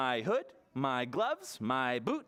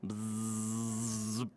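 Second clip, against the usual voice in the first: bee buzz; 7 semitones lower than usual. Acted. Bee arrives, buzz imitated.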